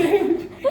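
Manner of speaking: high, loud laugh; real, helpless laugh; laughing out loud at the punchline; burst of laughter. Real, not acted.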